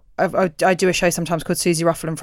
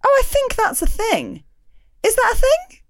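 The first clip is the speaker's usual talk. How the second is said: much higher, slow, tentative questioning; unsure mock voice. Comic doubting her own joke, high unsure voice. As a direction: squeak it out like a nervous beginner. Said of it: "It's like you go".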